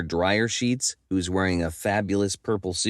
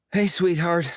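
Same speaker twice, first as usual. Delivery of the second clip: wheezing; 10 semitones higher than usual. Acted. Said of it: "I wheeze".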